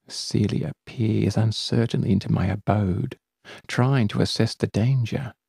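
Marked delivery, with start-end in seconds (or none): none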